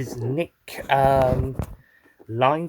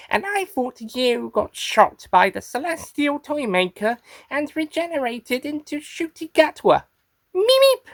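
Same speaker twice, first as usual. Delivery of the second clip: raised-pitch creature voice; Meep character voice; 8 semitones higher than usual. Acted. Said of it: "getting the voice into, uh, into character".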